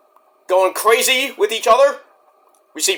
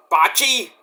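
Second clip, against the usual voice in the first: normal talking voice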